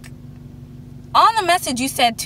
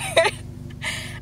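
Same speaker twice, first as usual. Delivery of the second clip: short high laugh; 5 dB quieter than usual. Real, not acted. Laughing after her point.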